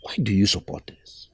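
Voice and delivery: hushed secret question; low whisper, leaning in. Acted. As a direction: whisper it so nobody overhears.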